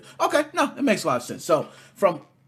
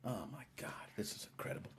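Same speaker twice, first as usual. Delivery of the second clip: hushed mock-awed whisper; host whispers, stunned by Kyle's graphics cards; 7 semitones lower, 23 dB quieter, faster than usual. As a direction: breathe it out in pretend awe.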